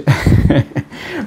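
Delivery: real chuckle before a confession; embarrassed laugh. Real, not acted. Rit avant d'avouer sa gêne. (petit rire gêné)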